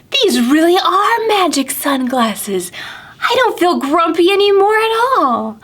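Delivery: high excited whoa, then a happy lilt; amazed delight. Acted. Pete amazed by the magic sunglasses, high and delighted. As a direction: marvel at the magic glasses. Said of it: "He looked around".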